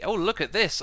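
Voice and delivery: ordinary delivery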